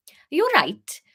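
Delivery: Harry asking lightly in Welsh; a hint of Welsh, airy; vague Welsh accent. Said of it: "I can vaguely do Welsh".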